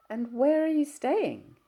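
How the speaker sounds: put-on young woman's voice.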